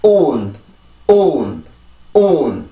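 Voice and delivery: 온 as a falling English vowel closing on N; word ending alone. Shown as a demonstration. Teacher drills the back part first, word ending alone. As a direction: sound out just 온, the end of June. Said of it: "뒤에만 먼저 발음을 해봅니다".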